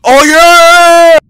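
high, loud, triumphant yell; hyped yell. Pretend. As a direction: shout it in triumph. Celebratory yells.

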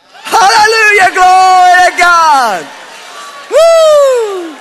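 high, drawn-out shout of praise ending in a whoop; rejoicing shout. Real, not acted. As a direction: let loose full-force rejoicing. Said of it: "guess what you need to do".

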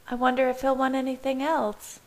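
high, musing character voice; reflective. A narrator's reading. Bella wonders about feeding the baby, reflectively. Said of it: "Bella said reflectively".

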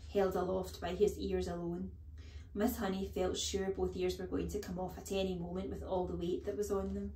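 ordinary delivery

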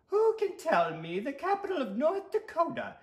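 very high-pitched prim lady teacher; schoolteacher voice. Acted. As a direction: quiz the class in a high falsetto. Geography teacher questions class.

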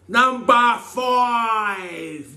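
very slow, high drawn-out call; game show announcer. Acted. Reading the next question, he announces it like a host. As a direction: draw it out like a quiz host.

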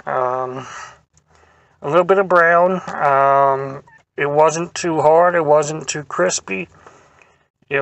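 talking between bites; chewing while talking. Real, not acted. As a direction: mouth full of waffle. Seen 0: Bites waffle from fork at 4.0, cheeks and jaw show chewing throughout.

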